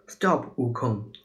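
plain reading voice

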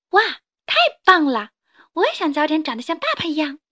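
put-on a kid amazed at dad; excited child. 小恐龙赞叹. (小孩子兴奋地赞叹)

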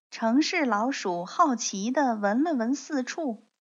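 usual speaking voice

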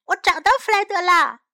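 high, loud, quick announcement; excited cry. A narrator's reading. Sam announces he found Fred, excited cry. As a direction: shout the news out fast. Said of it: "山姆叫了起来".

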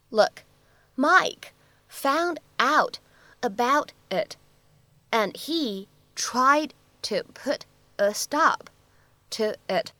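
slow, phrase-by-phrase model read; broken into chunks. Shown as a demonstration. Teacher slowly models the sentence in chunks for students. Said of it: "我们的发音技巧会非常的多啊".

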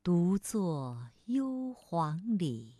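very slow, solemn reading; slow lyrical recital fading away; poem recitation. Acted. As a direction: recite the classical poem slowly, lingering.

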